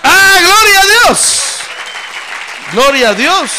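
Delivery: loud, high shouted praise; full-throated pulpit cry; preaching at full force. Acted. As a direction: lift it up like a revival preacher. Preaching exclamation.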